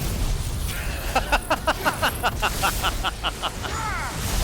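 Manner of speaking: laugh. Real, not acted. Laughs at own outburst.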